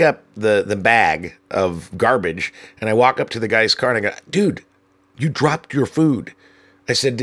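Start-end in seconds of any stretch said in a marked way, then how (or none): 4.3-6.3 mock-friendly, higher and slow; fake helpful. Narrator handing the litterer his trash, sarcastically helpful. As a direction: kill him with kindness. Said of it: "trying to be as helpful as possible".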